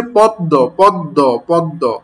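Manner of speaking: পদ্ম with an open aw, repeated deliberately; read as written. Shown as a demonstration. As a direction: say পদ্ম as "pawd-do". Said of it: "এটা হচ্ছে প্রকৃত উচ্চারণ".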